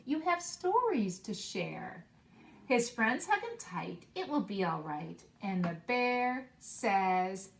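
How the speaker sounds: put-on expressive read-aloud for young children; story narration. Teacher reading a picture book to her class, expressive read-aloud. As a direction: read the picture book warmly and slowly, bringing each animal to life.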